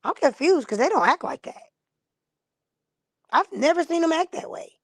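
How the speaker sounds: lightly voiced Aries, honestly baffled; mock puzzled Aries. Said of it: "The Aries gonna look at you like and say".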